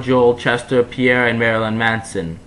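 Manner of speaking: ordinary delivery, nothing marked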